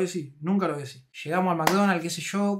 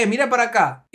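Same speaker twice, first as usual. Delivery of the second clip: loud, higher-pitched order; imitated bossy command; 6 semitones higher, 7 dB louder than usual. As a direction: demand attention for the photo. Fan ordering friend to face camera. (orden imitada, fuerte y mandona)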